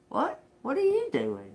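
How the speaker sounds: high, incredulous squeal; mock surprise. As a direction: act shocked he'd even try. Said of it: "Just be like".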